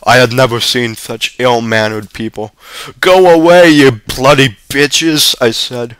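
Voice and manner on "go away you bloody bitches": loud, high shout, slowing on the insult; furious yell. A narrator's reading. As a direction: scream it at the women. Boy yelling at jeering women.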